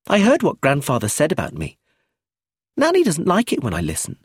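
put-on high little-girl voice; unashamed child eavesdropper. A narrator's reading. Child tells of eavesdropping on grandfather, unashamed. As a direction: tell it high, like a child with secrets.

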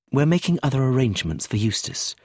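ordinary delivery

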